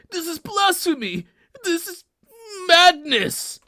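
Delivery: high, outraged protest; shocked outrage. Acted. Persian messenger protesting to the king, shocked outrage. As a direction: cry out in horror, pitched high.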